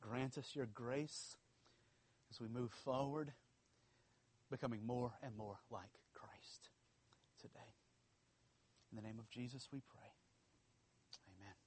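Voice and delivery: hushed, soft closing prayer. Acted. Pastor closes his prayer in a soft, hushed voice. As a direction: pray quietly over the congregation.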